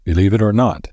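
plain reading voice

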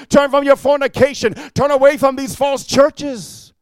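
high, loud pulpit exhortation; full-force preaching. Real, not acted. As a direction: drive it like a fiery preacher, phrase after phrase.

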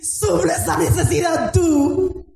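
high, fervent preaching cry; preaching at full force. Acted. Preacher rousing the congregation, fervent high cry. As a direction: lift it up and preach it to the church.

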